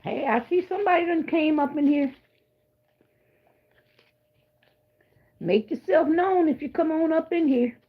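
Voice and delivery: high, airy sing-song hello; lilting welcome to the chat; sing-song greeting. Real, not acted. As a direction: call out sweetly to whoever joined.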